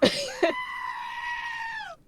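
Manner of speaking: high, shrieking burst of laughter; squealing laugh. Real, not acted. Streamer bursting into a shrieking laugh at chat.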